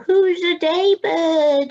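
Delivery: high, loud and slow crowing call; little owl calling out triumphantly, high and loud; proud shout. Acted. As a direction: shout it out into the dark. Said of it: "he shouted at the darkness".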